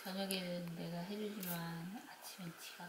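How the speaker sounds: hushed whisper aside. Real, not acted. Aside while child is nearby.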